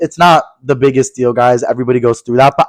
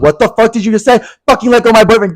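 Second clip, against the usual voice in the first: high-pitched indignant comeback; hammed-up high outrage; retold crazy outburst; 7 semitones higher, faster than usual. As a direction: act out an incensed confrontation. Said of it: "I was being crazy".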